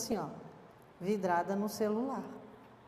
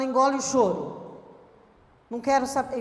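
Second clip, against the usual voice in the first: mock harsh scolding; 4 semitones higher, 6 dB louder than usual. Acting harsh parent scolding.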